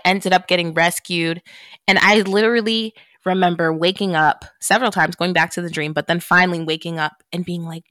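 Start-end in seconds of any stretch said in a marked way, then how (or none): none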